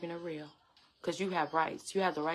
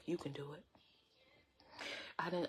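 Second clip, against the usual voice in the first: usual speaking voice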